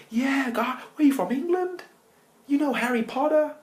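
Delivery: very high, loud, eager caricature; squeaky over-friendly local; friendly American biker. Acted. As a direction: gush with curiosity in a mock American voice. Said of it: "they started being really nice".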